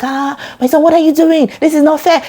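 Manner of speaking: a father shouting at his son to stop; mock father's shout. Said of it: "He'll be shouting".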